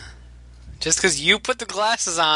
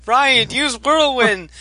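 slow, higher, louder battle call; hero command cry; 7 semitones higher, 4 dB louder, slower than usual. Retold or imitated. Fan shouting the hero's attack call to Ryan, loud. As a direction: call the move out like a cartoon ally.

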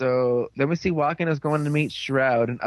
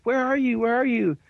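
high-pitched calling around for someone; imitated searching call; 9 semitones higher than usual. Detective searching the office for his partner, mock eager calling. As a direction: hunt for a friend, calling in a raised voice. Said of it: "he's searching around the, the, the office like".

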